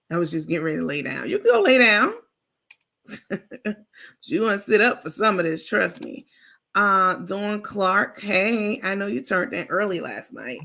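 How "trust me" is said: normal talking voice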